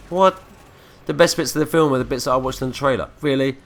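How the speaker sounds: loud, high-pitched incredulous reaction; indignant disbelief. Retold or imitated. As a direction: act out a cheated customer's disbelief. Acted let-down cinemagoer after hyped trailer.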